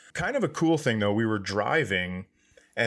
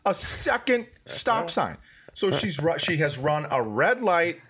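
ordinary delivery

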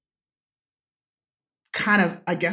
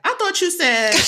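a lightly voiced cheeky kid, pitched up; teasing kid voice; 5 dB louder than usual. A daughter teases her mother with her own words, mildly cheeky. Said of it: "Kaya will sometimes be like".

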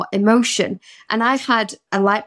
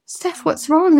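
higher-pitched imitation of a worried sister; concerned sister; 7 semitones higher, slower than usual. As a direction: lightly act out a sister's concern. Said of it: "she goes".

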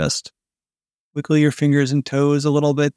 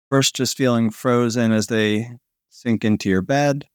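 sleep story narrator; 2 semitones lower than usual. Acted. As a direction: lull them toward sleep, breath by breath. Guided body-melting sleep relaxation.

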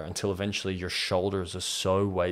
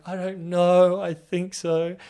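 1 nervous admission; 10 semitones higher, 8 dB louder, slower than usual. Retold or imitated. Admitting he likes guys.

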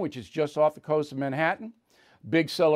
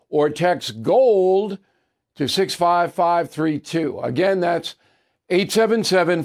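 ad read; 3 semitones higher, 7 dB louder than usual. Acted. Host pitching gold to listeners in a loud ad read. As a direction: sell the gold like a radio spot.

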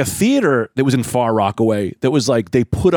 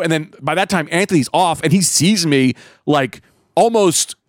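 ordinary talk, nothing marked

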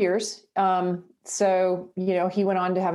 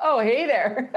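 laughing imitation, pitched up; George's catchphrase; 5 semitones higher, 3 dB louder, slower than usual. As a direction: playfully echo a catchphrase through laughter. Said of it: "your critique on George's "Oh, hey there".

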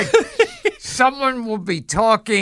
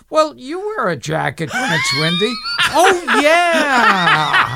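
imitating Frank; 5 semitones higher than usual. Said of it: "Frank will go".

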